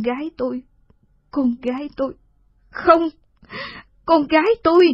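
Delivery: rising into a sobbing scream; anguished cry. Acted. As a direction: cry out in despair, building to a wail. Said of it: "Thị hét lên".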